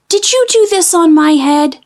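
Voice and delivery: high, earnest little mole; little mole asking. A narrator's reading. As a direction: ask it like a small puzzled mole. Mole asks the horse.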